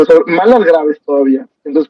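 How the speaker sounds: ordinary delivery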